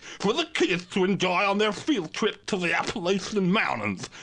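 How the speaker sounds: pompous TV pundit. Acted. TV pundit spiel.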